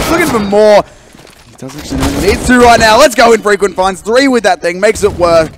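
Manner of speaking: high, loud shoutcast; hyped esports caster in full flow; excited play-by-play. Real, not acted. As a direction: build to a climax with each kill.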